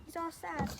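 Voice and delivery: plain reading voice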